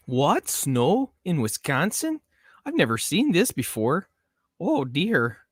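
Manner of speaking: high, loud mock Wisconsin accent; hammed-up shocked Wisconsin local. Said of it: "Did I, did I nail the Wisconsin voice?".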